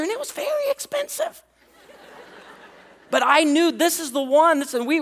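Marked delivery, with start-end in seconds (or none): 0.0-1.4 very high, pained squeak; comic squeak. Real, not acted. Preacher joking to congregation about the cost of courting, high squeak. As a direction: voice jumps way up for a comic complaint.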